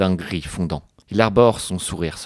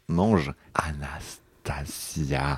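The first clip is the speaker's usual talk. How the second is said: very slow whisper, lingering on each syllable; sensual whisper; 5 semitones lower, 6 dB quieter, much slower than usual. Acted. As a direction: breathe every syllable of the name slowly. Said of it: "il faut que je le refasse".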